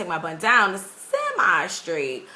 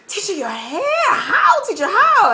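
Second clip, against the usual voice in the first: put-on rising into a high, louder amazed child voice; amazed kids; 11 semitones higher, 5 dB louder than usual. Students marvelling at the teacher's hair, high amazed voices. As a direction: voice the kids' astonishment climbing up.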